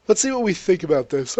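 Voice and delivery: talking through a yawn. Real, not acted. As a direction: let the words stretch into a yawn. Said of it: "Oh god, I'm yawning".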